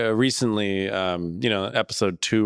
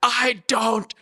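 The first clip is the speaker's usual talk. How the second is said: high, slow exasperated shout; hammed-up, drawn-out yell of protest; putting foot down; 11 semitones higher, 3 dB louder, much slower than usual. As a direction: stretch every word out as you reenact losing patience. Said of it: "finally had to put my foot down".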